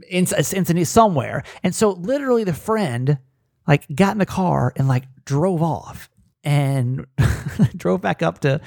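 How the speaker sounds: ordinary talk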